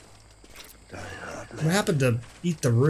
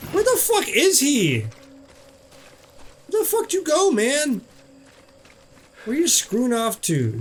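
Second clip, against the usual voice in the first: much higher, calling out for the hidden enemy; high-pitched frustrated call; 10 semitones higher than usual. Pretend. A gamer searching for the boss, in a high mock call. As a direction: squeak out annoyed questions.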